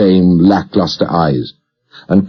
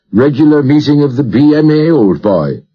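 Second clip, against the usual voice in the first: jovial Irish doctor, pitched high and lilting; high, sing-song Irish brogue; rich Irish brogue; 7 semitones higher than usual. A narrator's reading. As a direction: cut in cheerily in an Irish accent. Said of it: "went strangely with his Irish accent".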